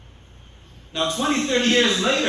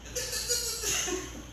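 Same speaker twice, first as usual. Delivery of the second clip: sudden high-pitched laugh; high amused laugh at his own nagging impression; 9 dB quieter than usual. Real, not acted. After mock nagging.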